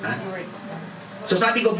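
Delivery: usual speaking voice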